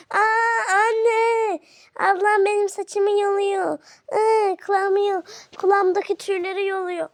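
loud, whiny cry for mum; hammed-up wail of complaint; wailing tattle. Child telling mother that sister pulls hair, mock wailing.

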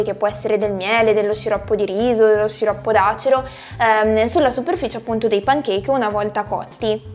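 ordinary talk, nothing marked

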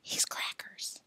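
hushed, secretive whisper; voiceless aside to a friend. A narrator's reading. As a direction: whisper it so he can't hear. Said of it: "Alan whispered".

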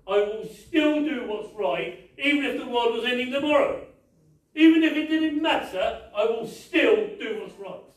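fiery sermon climax; preaching at full force. Acted. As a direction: hammer it home from the pulpit. Preacher driving the point home.

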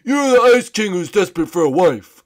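loud cartoon princess; put-on princess voice, loud and blunt; Raggedy Princess. Princess tells the Ice King who he is, loud cartoon voice. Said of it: "do you know who I am".

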